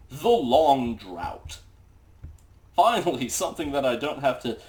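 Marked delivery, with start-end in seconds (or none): 0.1-1.6 slow and loud, each word weighted; title announcement. Acted. Reads out a book title.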